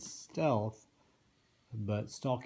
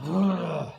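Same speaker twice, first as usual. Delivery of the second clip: grumpy dwarf groan; 6 semitones higher, 9 dB louder than usual. Retold or imitated.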